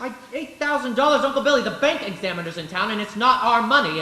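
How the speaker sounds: loud, high, shocked and rising anger; alarmed outburst. Acted. Nephew scolding his uncle over the missing money, loud and alarmed. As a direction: blow up at the lost money. George learns the money is lost.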